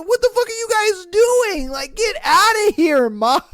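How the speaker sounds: high, loud mock yelling; hammed-up outraged yell; mock shout. Host acting mom and teen yelling, high and loud. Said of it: "mom walks in".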